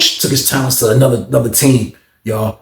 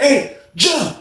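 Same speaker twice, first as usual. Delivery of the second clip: high call up the steps; imitating a high call to a neighbor; 10 semitones higher than usual. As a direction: call his name again, upward. Calling Jeff again.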